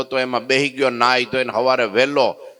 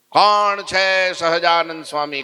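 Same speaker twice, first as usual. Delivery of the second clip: challenging shout; 6 semitones higher, slower than usual. Acted. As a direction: bellow the challenge at the crowd. Sadhu barges into the assembly. (ઊંચા અવાજે પડકાર)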